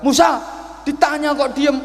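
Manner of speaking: high, loud, hurried demand; impatient old man. Retold or imitated. Old man impatiently demanding Musa's answer, loud and hurried. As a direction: press him for an answer, fast and loud. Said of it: "nggak sabar".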